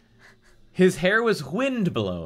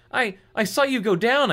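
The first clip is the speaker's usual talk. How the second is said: stammering; 9 semitones higher, 3 dB louder than usual. Acted. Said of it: "I stammered".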